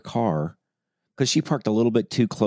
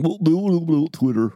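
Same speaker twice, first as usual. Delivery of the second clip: mock chatter; 9 semitones higher than usual.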